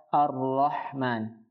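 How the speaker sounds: "Ar-Rahman" slow, breathy throat h; slow "Ar-Rahman" with clear ha; correct ha. Shown as a demonstration. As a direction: stretch "Ar-Rahman", h from mid throat. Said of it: "ha juga jangan dibaca ha maupun kha".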